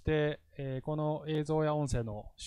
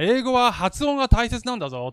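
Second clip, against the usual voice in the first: louder, higher pompous teacher lecturing; bossy teacher; 8 semitones higher, 7 dB louder than usual. Retold or imitated. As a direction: lecture the class like a self-important teacher. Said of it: "とかって言いながら". (威張った先生の口調で、高く大きく)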